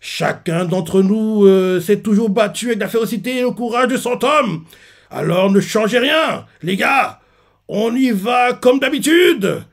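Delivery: rally them calmly but firmly; rallying speech. Retold or imitated. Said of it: "il crie à ses hommes".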